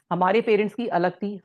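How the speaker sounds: ordinary talk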